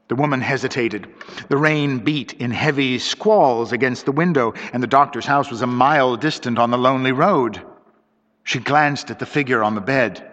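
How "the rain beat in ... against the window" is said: usual speaking voice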